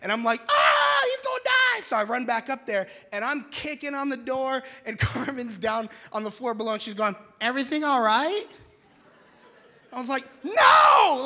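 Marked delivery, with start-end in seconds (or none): 0.5-1.8 high, loud mock panic; panicked scream. Retelling his panic at the fire. 7.4-8.5 a hint of wife's higher call. Wife calling up from floor below. 10.4-11.2 high, very loud mock scream; panicked shout. Shouting back to wife in emergency.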